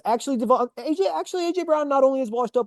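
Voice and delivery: high, whiny mock fan voice, lightly done; a hint of a flustered fan backpedalling; mocking Eagles fan. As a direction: podcaster mocks Eagles fans' excuses in a high, whiny voice. Mocking frantic Eagles fans' excuses.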